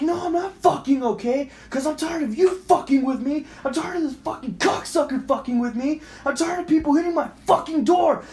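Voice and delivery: high, ranting re-enacted yell; retold going off. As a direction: rant in fed-up rage. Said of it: "I'm fucking going off".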